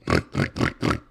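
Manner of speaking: series of demonstrated pig snorts; low, rattling imitated snorts; snorting sound. Shown as a demonstration. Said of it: "what a snorting sound sounds like".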